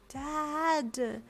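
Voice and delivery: put-on high, drawn-out child voice full of sass; sassy little girl. A narrator's reading. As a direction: scold dad like an embarrassed four-year-old. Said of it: "she sassed him".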